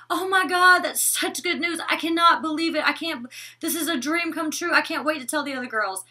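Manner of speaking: high, loud gushing joy; overjoyed gushing. Pretend. Vlogger imagining Olivia thrilled at good news, high excited gush. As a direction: squeal the good news like a dream come true. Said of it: "she'd be like".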